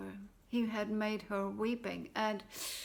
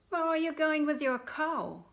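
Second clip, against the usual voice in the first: curious stranger; 6 semitones higher, 5 dB louder than usual. Acted. Stranger questions Jack.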